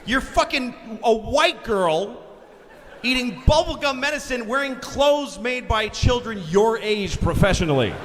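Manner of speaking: high, drawn-out comic rant; hammed-up indignant parent; outraged scolding. Berating spoilt daughter in bit.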